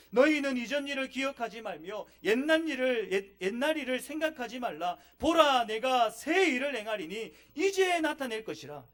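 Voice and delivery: declaiming Isaiah; solemn scripture reading. Real, not acted. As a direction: read it as God's own word. Reading scripture aloud forcefully.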